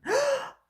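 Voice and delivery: a sudden high sharp intake of breath; sharp high gasp. A narrator's reading. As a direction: gasp in fright, high and loud. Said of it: "she gasped".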